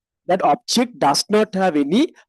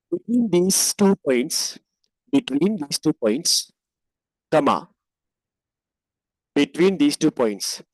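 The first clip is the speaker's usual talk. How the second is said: dictating. Shown as a demonstration. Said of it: "write like this".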